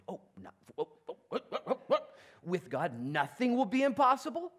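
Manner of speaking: rising, climbing to a laughing question; astonished disbelief. Acted. Mary grasping the angel's words, astonished and rising. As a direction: stammer then burst into wonder.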